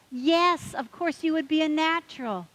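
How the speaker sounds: a hearty, insistent yes; emphatic bartender. Retold or imitated.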